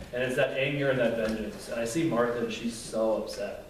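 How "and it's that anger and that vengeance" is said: normal talking voice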